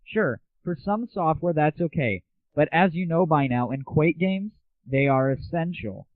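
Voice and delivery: ordinary talk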